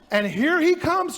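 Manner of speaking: usual speaking voice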